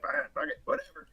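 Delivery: soft grumbled imitation, barely voiced; salty. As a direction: give in grudgingly under your breath. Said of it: "he's salty".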